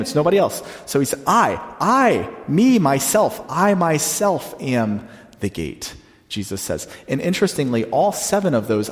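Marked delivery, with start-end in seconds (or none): 1.3-5.9 "I" hit high and hard, then slow "I myself am the gate"; emphatic I. Shown as a demonstration. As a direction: hammer home the "I" each time, slowly. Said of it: "as if to emphasize that it's, it's him".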